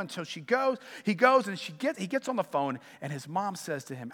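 normal talking voice

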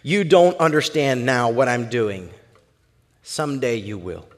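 low, measured, softening reply; calm Jesus. Acted. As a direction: answer gently and slowly, dropping at the end. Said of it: "Jesus replied".

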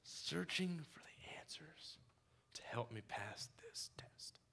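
soft unvoiced whisper; hushed repeat of the words. Shown as a demonstration. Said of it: "repeating line as whisper".

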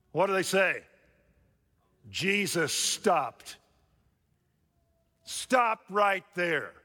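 high, loud sermon drive; rally the room, full volume; preaching at full force. Acted.

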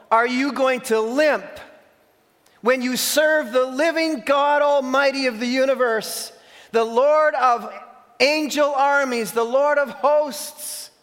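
high, loud, slow preacher's cry; fiery sermon climax, loud and high; preaching at full force. Acted. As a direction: thunder the challenge at the crowd. Preacher thunders Elijah's challenge.

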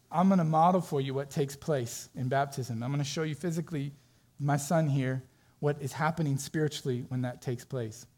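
usual speaking voice

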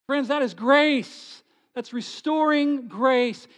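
loud, high, slow proclamation; preaching at full force. Real, not acted. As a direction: proclaim it to the whole room. Preaching climax on grace.

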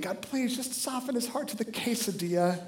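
retold desperate prayer, kept hushed; pleading prayer. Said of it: "and I'm praying".

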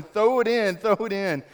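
high, loud yell fading down; everybody yelling. Retold or imitated. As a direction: start loud, then ease off. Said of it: "everybody's yelling".

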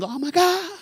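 put-on high little boy gasping in wonder; a kid staring at costumes; amazed little boy. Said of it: "he was pointing".

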